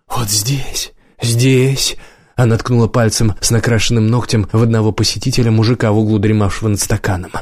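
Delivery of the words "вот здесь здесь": urgent half-whisper, broken by pauses; hot loud whisper. A narrator's reading. Woman pointing out the dragon everywhere, hot half-whisper. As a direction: breathe each word out hotly, place by place. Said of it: "прошептала она горячо и громко".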